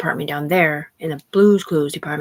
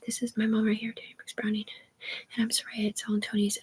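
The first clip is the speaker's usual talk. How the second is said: crying whisper; 4 semitones higher, 7 dB quieter than usual. Real, not acted. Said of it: "I'm crying".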